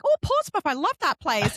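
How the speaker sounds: high excited "Oh, Portsmouth"; imitating her own thrilled reaction; gushing delight. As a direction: squeal with delight at the name. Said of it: "'cause I'm like".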